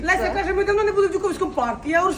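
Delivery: fast, loud, high retelling through laughter; giggling, excited chatter; laughing. Real, not acted. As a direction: laugh while telling what Леся said.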